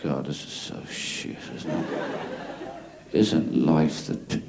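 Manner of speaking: very low, soft, slow stoner drone; breathy half-whispered stoned apathy; stoned murmur. Retold or imitated. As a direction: sunk in the sofa, barely bothering. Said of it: "kind of like".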